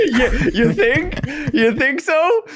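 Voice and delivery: high, slow laughing disbelief; laughing at an obvious understatement. Real, not acted. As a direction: tease through laughter. Teasing reply to legally gray.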